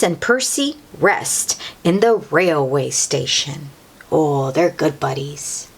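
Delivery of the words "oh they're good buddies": plain reading voice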